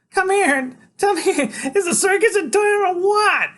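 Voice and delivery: high, loud, laughing side-mouth voice. A narrator's reading. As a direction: talk out of the corner of the mouth, cackling. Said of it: "she says out of the side of her mouth".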